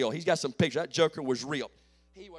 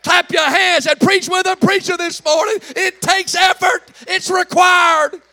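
loud, high, rhythmic preaching; full-force preaching; 8 semitones higher, 12 dB louder than usual. Real, not acted. Preacher building to a shout for the congregation. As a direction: whoop it up to the rafters.

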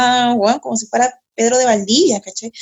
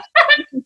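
high burst of laughter; real laugh. Real, not acted. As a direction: crack up at your own story. Laughing at her own farting anecdote.

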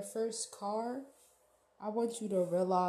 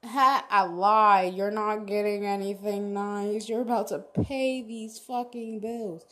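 taunting paycheck; 9 dB louder than usual. Retold or imitated. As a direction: voice the paycheck gloating loudly. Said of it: "paycheck is like a slap in the face talking about".

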